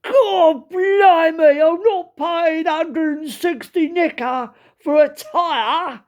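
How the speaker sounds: loud, high, drawn-out Cockney outrage. Retold or imitated. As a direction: balk at the price like an outraged East Ender. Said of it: "And the Cockney went".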